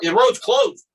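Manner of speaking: high, loud mock shout; retold angry refusal. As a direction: imitate an official snapping back, voice jumping up. Retold argument at road closure.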